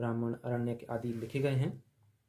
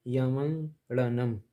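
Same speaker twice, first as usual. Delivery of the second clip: यमंगड़नम stretched slowly syllable by syllable; slow careful reading; 4 dB louder, much slower than usual. Shown as a demonstration. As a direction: draw out यमंगड़नम clearly. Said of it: "इसको ऐसे पढ़ें".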